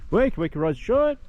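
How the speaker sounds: high, drawn-out holler; shouting. Real, not acted. Hiker making lots of noise down through the meadow, shouting. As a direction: call out loud and high to rouse everything around. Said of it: "just a fair bit of shouting".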